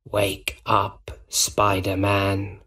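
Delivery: slow, creepy whisper; quiet villain whisper. A narrator's reading. Goblin wakes the drugged Spider-Man, sinister whisper. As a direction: whisper it menacingly, drawn out. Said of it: "the Green Goblin said quietly".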